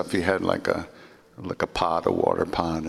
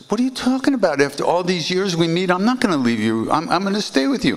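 higher, louder protest of disbelief; protesting sadhu; 10 semitones higher, 4 dB louder than usual. Retold or imitated. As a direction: refuse to be sent away. Sadhu refusing to leave.

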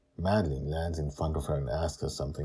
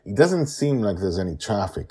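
plain reading voice

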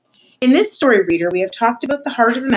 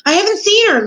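high, louder indignant cry; shouting; 12 semitones higher, 5 dB louder than usual. A narrator's reading. Louise disowning the missing serving girl, shouting. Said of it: "Louise was shouting".

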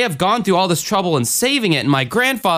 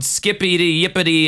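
usual speaking voice

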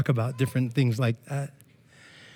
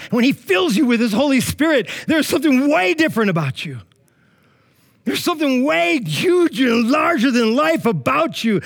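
raised pitch, driving sermon delivery; fervent preaching; 7 semitones higher, 3 dB louder than usual. Real, not acted. Youth preacher challenging the congregation about following Jesus, fervent preaching. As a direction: preach it with urgent, rolling momentum.

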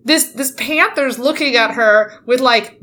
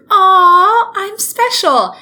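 high, loud mock swoon; mock flattered girl; 9 semitones higher, 5 dB louder, much slower than usual. Reader mocking the flattered girl, high swoon. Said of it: "and you think".

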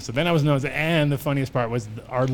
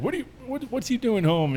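puzzled grandfather; 10 semitones higher than usual. Retold or imitated. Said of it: "he's like".

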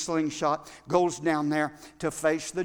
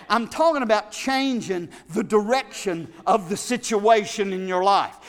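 rising, louder sermon delivery; full-force preaching; 4 semitones higher, 7 dB louder than usual. Acted. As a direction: preach it, building with each line.

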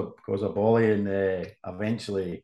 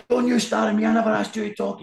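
much higher, louder furious yell; shouting boss; 13 semitones higher, 5 dB louder than usual. Retold or imitated. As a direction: shout him down for answering back. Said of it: "he's shouting at me".

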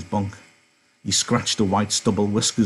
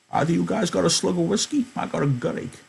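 put-on high, thin old-timer's voice; old Candy voice; 9 semitones higher than usual. Old Candy greeting Slim and George, high-pitched. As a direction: pitch up into a creaky old ranch hand.

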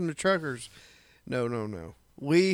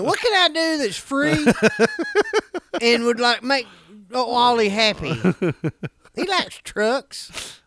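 mom voice; 5 semitones higher than usual. Retold or imitated. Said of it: "that's mom saying".

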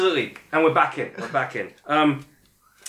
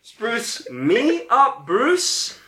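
slower, drawn-out activation phrase; slow emphatic command; 6 semitones higher, 4 dB louder, much slower than usual. Shown as a demonstration. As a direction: say it like you mean it. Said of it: "Say it like you mean it".

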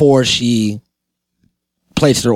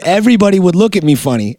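normal talking voice

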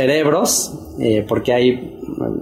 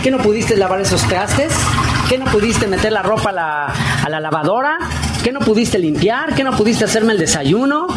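mock angry nagging; 8 semitones higher, faster than usual. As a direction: pretend to chew him out over the chores. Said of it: "Y obviamente con gritos, con un mal tono, de, con genio". (esposa regañando al marido, enojo fingido y agudo)

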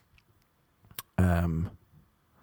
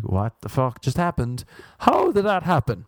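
rising, higher baffled what-the-fuck; baffled disbelief; 5 semitones higher than usual. Retold or imitated. Comic to crowd, about people making plans: baffled, higher. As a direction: throw up your hands in disbelief.

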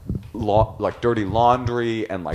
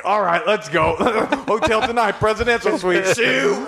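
much higher, laughing brag; mock triumph; 11 semitones higher than usual. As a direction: crow it with laughter. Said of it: "And we're like".

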